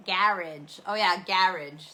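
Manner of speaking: "garage" as "GARR-idge", said loud; loud British "garage". Shown as a demonstration. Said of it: "that's how they say it here".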